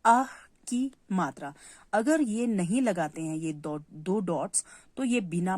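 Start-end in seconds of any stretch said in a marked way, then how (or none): 0.1-0.3 "आह" with a breathy "ह" tail; visarga demo. Shown as a demonstration. Teacher repeats the "आह" example for pupils. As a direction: let the "ह" breathe after the "आ". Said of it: "देखिए अ के बाद ह sound".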